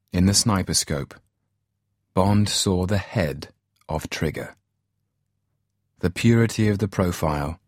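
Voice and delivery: plain reading voice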